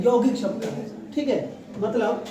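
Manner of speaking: ordinary talk, nothing marked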